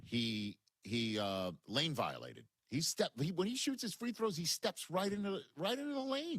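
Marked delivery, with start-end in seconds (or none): 2.7-6.4 climbing higher, disbelieving; rising incredulity. Real, not acted. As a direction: build the pitch up in disbelief.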